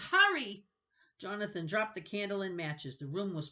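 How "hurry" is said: high, loud panicked shout; panicked cry. Acted. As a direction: scream for him to hurry. Drowning boy pleads.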